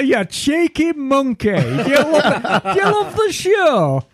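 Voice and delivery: high, laughing mock interrogation; rising playful laughing tease; teasing through laughter. Real, not acted. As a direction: tease him while laughing. Teasing the emailer.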